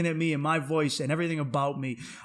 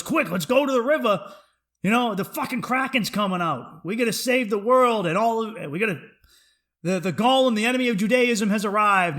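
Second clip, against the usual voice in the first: loud, raised, mock-epic alarm; rally them to battle, playfully; imitated urgent summons; 7 semitones higher, 7 dB louder than usual. Acted urgent call to battle.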